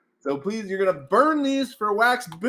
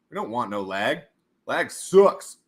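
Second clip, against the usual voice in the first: ordinary delivery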